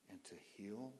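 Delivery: soft whisper on and to heal. Real, not acted. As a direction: breathe the words and to heal. Sermon drops to a whisper.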